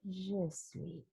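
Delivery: chuchoté, très doux; mantra chuchoté. Shown as a demonstration. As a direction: glisse vers le sommeil en chuchotant. (chuchotement endormi)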